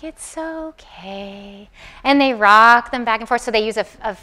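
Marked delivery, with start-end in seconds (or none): 0.0-1.7 slow, much softer, lower soothing voice; soothing caregiver. Shown as a demonstration. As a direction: hush a crying baby gently. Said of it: "caregivers say things like".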